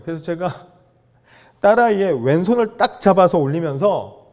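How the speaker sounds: usual speaking voice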